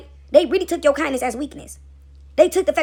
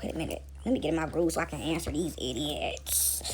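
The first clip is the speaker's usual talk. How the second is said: low, hushed, grumbling Unc; whispery, weary mock grumble; imitating Unc; 7 semitones lower, 10 dB quieter than usual. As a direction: sigh it out under the breath, put-upon. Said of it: "like six oh seven Unc said".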